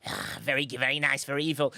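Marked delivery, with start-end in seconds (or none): none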